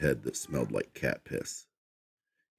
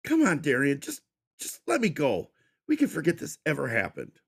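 high, fast nervous pleading; whiny pleading; 12 semitones higher than usual. Acted. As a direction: stammer out a desperate deal. Captive pleads with captor.

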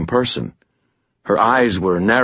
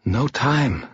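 soft, higher-pitched gentle voice; tender and light; 5 semitones higher, 4 dB quieter than usual. A narrator's reading. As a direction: say it gently to hush him. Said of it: "she said gently".

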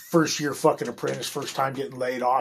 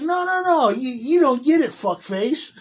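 higher, heated comeback at the boss; retold angry retort; 10 semitones higher than usual. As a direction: replay the anger at being laid off. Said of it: "That's why I was fucking pissed".

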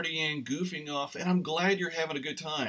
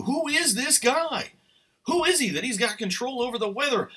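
much higher, louder, slowed wonder; amazed disciple; 10 semitones higher, 5 dB louder than usual. Retold or imitated. Imagining himself a disciple in the storm, loud and amazed. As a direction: gasp it out like a stunned disciple. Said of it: "if I was one of the disciples".

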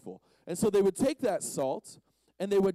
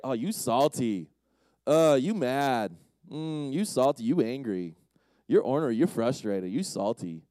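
loud, low mock jeer; taunting insult; 5 semitones lower, 6 dB louder than usual. Preacher acts out people calling someone salty, taunting. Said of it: "We've made that a bad thing".

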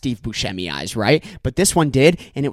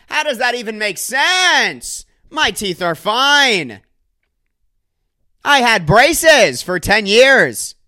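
high, slow, drawn-out indignant protest; John Mulaney; 8 semitones higher, 5 dB louder, much slower than usual. Retold or imitated. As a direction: wail like a bullied comic, stretching the last words. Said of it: "John Mulaney getting bullied".

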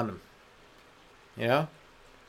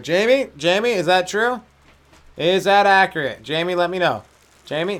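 louder, higher call across the room; calling to the producer to check a fact; 5 semitones higher, 5 dB louder than usual. Real, not acted. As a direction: call out to Jamie for an answer.

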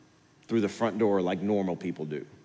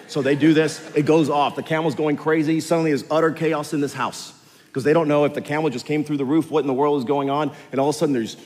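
higher, louder, building story; preaching at full force; 7 semitones higher, 9 dB louder than usual. A narrator's reading. Preacher telling the crowd the chaos, higher and louder.

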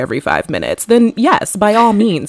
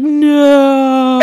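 shocked no; 5 semitones higher, 3 dB louder than usual. Retold or imitated. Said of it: "I'd be like".

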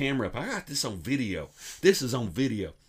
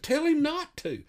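high mock voice; 13 semitones higher than usual. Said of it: "I said".

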